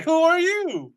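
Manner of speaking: very high-pitched retold question; hammed-up high challenge; mock indignant surprise. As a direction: act out walking in on a stranger. Husband finds stranger at home.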